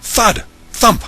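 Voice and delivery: loud sound words. A narrator's reading. Narrator reads the crashes, loud punchy sound words. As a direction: punch each noise loudly. Sound effects read loudly.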